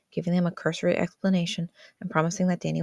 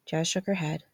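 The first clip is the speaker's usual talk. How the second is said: plain reading voice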